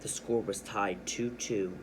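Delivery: usual speaking voice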